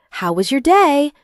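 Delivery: high, bouncy sing-song mimicry; silly sing-song. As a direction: sing it like a cheesy mum. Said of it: "in a silly sing-song voice".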